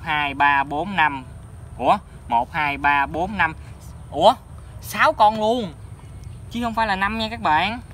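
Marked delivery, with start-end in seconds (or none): 4.1-7.8 surprised. Real, not acted. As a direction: blurt it out in amazement. Finds six fish, not five.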